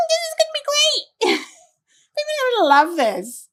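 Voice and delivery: very high squeaky mocking voice; squealing falsetto enthusiasm; mock HR person. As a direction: parody a gleeful, clueless HR worker. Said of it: "that person sat in H R saying".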